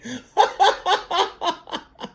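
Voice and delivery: high, loud burst of laughter; big laugh. Real, not acted. Host cracking up at the dead president's thanks, loud laugh. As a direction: laugh at your own joke.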